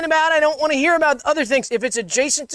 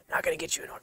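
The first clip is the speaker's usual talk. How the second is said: whisper; 14 dB quieter, much faster than usual. Real, not acted. Whispered aside after joke.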